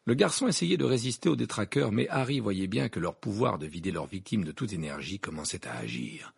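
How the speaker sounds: plain reading voice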